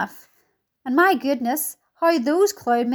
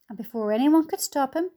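usual speaking voice

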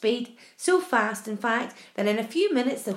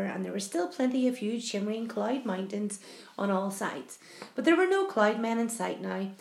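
plain reading voice

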